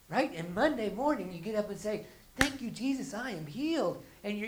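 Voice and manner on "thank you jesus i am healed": higher, louder gushing thanks; mock joyful praise. Churchgoer on Monday morning, mock joyful praise. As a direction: gush thanks like you're healed.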